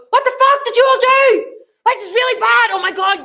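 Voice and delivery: high, loud outburst of blame; angry blaming. Shown as a demonstration. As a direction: demonstrate a boss flying off the handle. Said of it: "Don't go".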